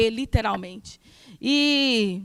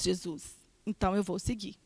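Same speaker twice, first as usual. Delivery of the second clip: hushed prayer; 5 semitones lower, 10 dB quieter than usual. Retold or imitated. Quiet prayer of surrender.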